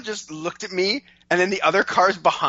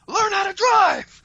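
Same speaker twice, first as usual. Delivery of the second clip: slow, very high, loud mock scream; escalated imitated yell; 5 dB louder, slower than usual. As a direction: yell it drawn out, a notch angrier. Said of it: "she went up a notch".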